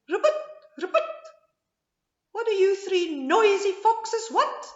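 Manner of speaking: high, fast ribbiting frog; croaking frog. A narrator's reading. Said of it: "the frog asked".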